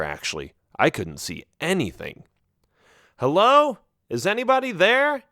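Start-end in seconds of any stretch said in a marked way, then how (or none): 3.2-5.2 shouted call. A narrator's reading. Hero calling into a dark cave, shouting. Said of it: "I shouted".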